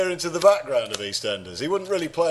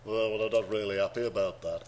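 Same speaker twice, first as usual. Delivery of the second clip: low, soft, glum Beatle voice; [name]; 8 semitones lower, 7 dB quieter than usual. Acted. Podcaster having a go at a Beatle, low soft accent. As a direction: drop low and quiet in put-on accent. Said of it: "shall I give George a go?".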